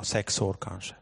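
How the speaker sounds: ordinary delivery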